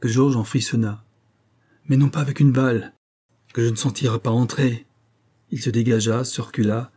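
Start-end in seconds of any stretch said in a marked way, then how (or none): 1.9-4.9 raised, then restrained hatred; a touch of vengeful rage; hate and rage. A narrator's reading. Norbert refuses his rival a quick bullet, hateful. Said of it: "avec un tel accent de haine et de rage".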